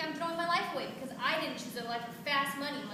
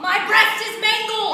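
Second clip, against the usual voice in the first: louder, slower, higher yell; anguished yell; 7 semitones higher, 13 dB louder, slower than usual. Acted. Betty yelling at Kyle about her mangled breast. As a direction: howl it out.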